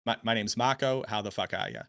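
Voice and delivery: thick Boston North Shore greeting; casual Boston-accented hello. Shown as a demonstration. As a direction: introduce yourself in broad Bostonian. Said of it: "this is how I would talk".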